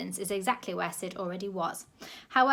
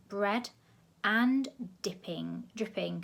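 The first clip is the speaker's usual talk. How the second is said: ordinary delivery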